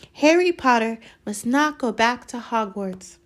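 high, loud, earnest elf voice; devoted house-elf. Acted. Dobby come to protect Harry, urgent warning.

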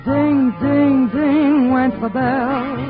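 ordinary talk, nothing marked

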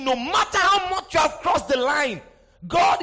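loud, high preached declaration; preaching. Acted. Preacher assuring sinners of restoration. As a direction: proclaim it over the music.

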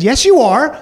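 insisting. Retold or imitated. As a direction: contradict him with mild conviction.

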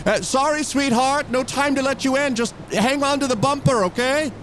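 calling out. Acted. Calls back from the taxi.

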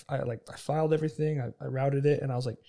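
ordinary talk

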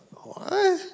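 high, startled "What?"; a bewildered, high-pitched "What?"; baffled what. Retold or imitated. Baffled reaction to eating every room.

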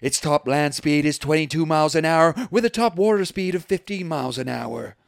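big mock ring announcer voice. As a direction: announce a contender entering the ring. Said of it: "future as a ring announcer".

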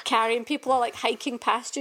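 ordinary talk

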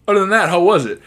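loud, high eager question; high hopeful shout. Retold or imitated. Albert asking Hitch about the kiss, high loud hopeful. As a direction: blurt it out, hopeful. Albert asks after the scolding.

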